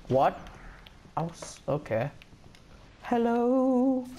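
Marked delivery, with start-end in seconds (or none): 3.0-4.0 high sing-song hello. Real, not acted. Greets killed friend.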